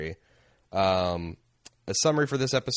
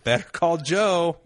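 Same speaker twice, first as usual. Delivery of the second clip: high, slow TV ad slogan; announcer slogan voice; 8 semitones higher, 5 dB louder than usual. Pretend. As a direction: sell it like a cheesy commercial tagline. Riffing on show slogan.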